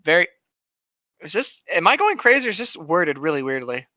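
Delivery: ordinary delivery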